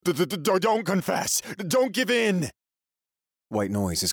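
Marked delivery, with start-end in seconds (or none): 0.0-2.5 stuttering high panicked scream. Acted. Eight screaming warnings to the narrator, high and stuttering. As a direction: scream a desperate warning through the stammer. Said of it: "Eight is screaming at me".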